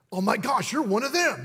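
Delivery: put-on teen realizing betrayal, aghast; hammed-up horrified girl; disgusted teen. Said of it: "that was her response".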